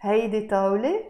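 fast, rising on طاولة; change the tune to ask; question intonation. Shown as a demonstration. Teacher showing how to form a yes-no question. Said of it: "change your voice and say".

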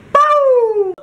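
very high, drawn-out boo; a long high mock-scare hoot; playful boo. Host signing off to viewers, hooting playfully.

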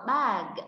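"Bag" with the final g released; clear final consonant. Shown as a demonstration. Teacher points out the last letter, "Bag" with audible final g. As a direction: let the g at the end of "Bag" be heard. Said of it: "Con thấy chữ cờ ở cuối đúng không".

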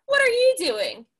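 high, curious beaver question. Acted. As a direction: beaver asks brightly. Beaver voiced in story.

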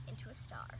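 whispered teaser; whispered reveal. Acted. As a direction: let it slip like a secret. Playful hushed reveal.

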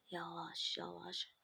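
tense whisper, sneaking; whispering while hiding. Acted. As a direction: breathe the words, unseen. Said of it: "sessiz olmalıyım".

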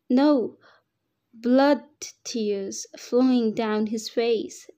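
ordinary delivery

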